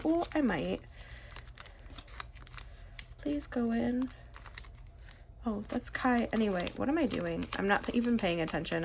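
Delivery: ordinary delivery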